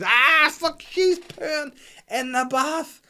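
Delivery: high, loud mock-panic shriek; horrified scream. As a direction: shriek in hammed-up horror at the discovery. Acts out dad's horrified discovery.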